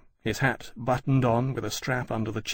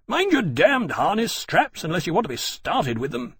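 higher, raised-voice scolding; sharp reprimand; 7 semitones higher, 3 dB louder than usual. A narrator's reading. Officer scolding an ensign about harness straps, sharp. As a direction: snap a sharp warning at a subordinate. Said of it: "Granby called sharply".

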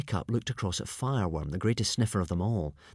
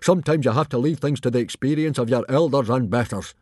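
put-on loud, blustering chief lecturing his boy; forceful, loud scolding in a put-on voice; Stoic; 3 semitones higher, 8 dB louder than usual. A narrator's reading. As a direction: boom it out as an overbearing father. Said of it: "said Stoic".

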